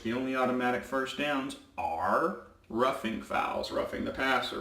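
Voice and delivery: usual speaking voice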